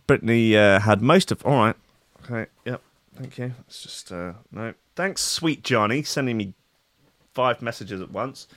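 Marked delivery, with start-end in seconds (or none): 2.2-8.5 dropped way down, almost under the breath; quiet aside. Real, not acted. Muttering off to the side about a friend's flood of messages. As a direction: mutter it off-mic to yourself.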